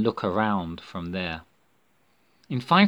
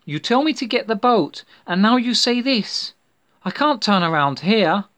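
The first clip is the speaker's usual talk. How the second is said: shocked protest; 11 semitones higher, 7 dB louder than usual. Acted. Shocked girl protests to friend.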